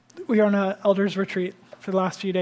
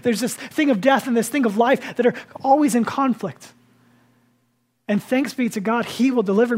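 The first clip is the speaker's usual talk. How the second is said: raised, louder preaching voice; impassioned preaching; 3 semitones higher, 4 dB louder than usual. Acted. Pastor preaching to his church, fervent and raised. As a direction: preach it out to the congregation, building fervor.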